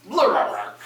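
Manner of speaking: underwater shout. A narrator's reading. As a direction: shout it as if through water. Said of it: "he shouts underwater".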